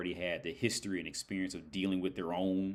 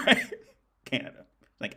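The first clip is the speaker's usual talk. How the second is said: ordinary delivery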